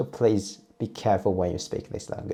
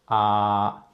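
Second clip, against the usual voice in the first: "ah" held long on one level pitch; flat tone; 4 dB louder than usual. Shown as a demonstration. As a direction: sustain a flat, even "ah", as for the dentist. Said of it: "a perfect flat tone".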